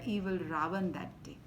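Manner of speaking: usual speaking voice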